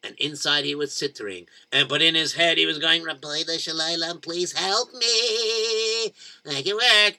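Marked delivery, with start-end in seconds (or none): 3.0-7.2 drawn-out inner plea; slow pleading prayer. Acted. Zalmi praying in his head, slow and pleading. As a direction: beg slowly.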